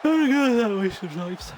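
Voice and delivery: words stretched through a big yawn. Real, not acted. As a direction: talk mid-yawn, loud and slurred. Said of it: "But I yawned, so it sounded like I was drunk".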